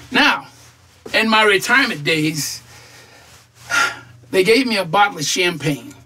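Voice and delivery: normal talking voice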